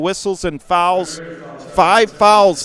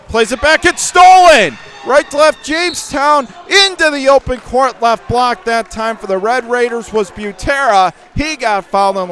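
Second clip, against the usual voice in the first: excited call; 6 semitones higher, 4 dB louder than usual. Real, not acted. Steal and fast break.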